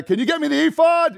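high, loud request to a servant; demanding call. Acted. Eli sends his attendant for something, calling loudly. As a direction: call out an order across the house.

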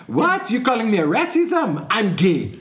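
outraged clerk denying racism, pitch jumps up; mock-indignant clerk with French accent. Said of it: "staying silent".

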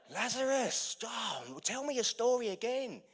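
a hint of a chummy drinker begging; eager pub punter. Drinker at the pub begging Lazarus for his story, laughing eagerly.

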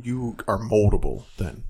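usual speaking voice

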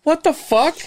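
high-pitched retold outrage; pissed-off disbelief. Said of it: "I'm fucking getting pissed".

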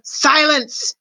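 high, mildly roared order. A narrator's reading. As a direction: command the crowd to be silent. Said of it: "roared the very honored head mouse".

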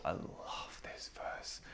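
slow, emphatic whisper; breathy-soft whispered confession of love; hushed adoration. Real, not acted. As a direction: whisper it slowly, savouring every word. Gushing over favourite Bible verse.

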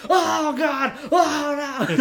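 high, loud laughing mock cries; hammed-up wrestler pain howls; comic overselling. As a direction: ham up every hit for laughs. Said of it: "comedy overselling".

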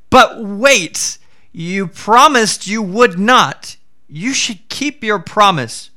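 a frightened priest holding the tiger to his word; Brahmin protesting. Acted. Brahmin voiced in folk tale.

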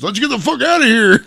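high, loud retold exclamation; hammed-up urgent escape; mock panic. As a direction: shout as if fleeing a beating. Rival flees, acted.

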